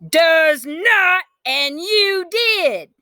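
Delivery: high loud tearful shout. A narrator's reading. Girl shouting at her sister in a quarrel. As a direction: yell back near tears.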